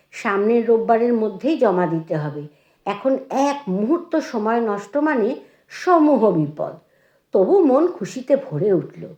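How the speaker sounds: ordinary delivery, nothing marked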